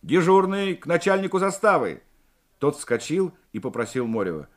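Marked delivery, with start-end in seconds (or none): 0.0-2.0 raised, higher calling voice. A narrator's reading. Morev relays the commander's order to the sergeant, calling out. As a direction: call the order out to a junior sergeant. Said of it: "Морев крикнул младшему сержанту Петревичу".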